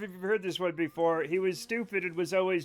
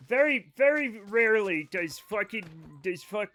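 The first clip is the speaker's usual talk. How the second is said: normal talking voice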